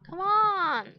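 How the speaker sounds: loud, high exasperated come on; frustrated shout. Real, not acted. Player shouting at her Sim, fed-up yell.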